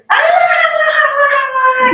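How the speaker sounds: imitating the sister's excited shout; shouted hala. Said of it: "Mbak saya".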